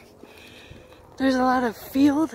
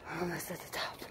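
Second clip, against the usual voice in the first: whisper; 5 semitones lower, 16 dB quieter, faster than usual. Real, not acted. Winded near summit.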